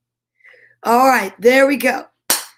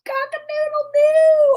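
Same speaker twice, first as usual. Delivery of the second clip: high-pitched, sustained crowing; rooster crow. Acted. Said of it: "The rooster".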